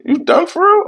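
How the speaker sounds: very high squeaky surprised friend; hammed-up high friend voice. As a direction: shriek the question in disbelief. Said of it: "He like".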